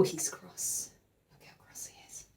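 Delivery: soft conspiratorial whisper. Real, not acted. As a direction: whisper it like a secret. Aside to children, whispered.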